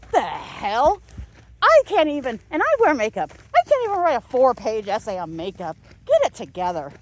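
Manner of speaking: loud exasperated scolding; indignant outburst. Real, not acted. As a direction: scold them in disbelief. Indignant rant at teacher.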